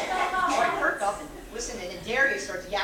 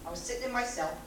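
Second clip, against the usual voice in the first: ordinary delivery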